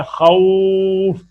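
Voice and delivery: खौफ with relaxed lips, slowly stretched; soft lengthened vowel. Shown as a demonstration. Teacher showing the soft vao leen, slow and relaxed. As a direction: let खौफ flow long and soft. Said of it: "होंठों को नरम रखकर".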